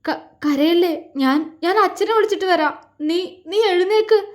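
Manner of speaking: comforting her while choking up; tearful. A narrator's reading. Tearful husband stammers comfort.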